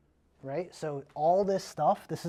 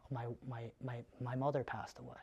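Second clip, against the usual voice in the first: mild grief; 5 semitones lower, 12 dB quieter than usual. Acted. Seller in roleplay.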